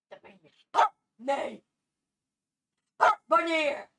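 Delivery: loud, disbelieving "Dat meen je niet"; loud surprise. Real, not acted. Hearing surprising news, loud astonished reaction. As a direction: react in shock.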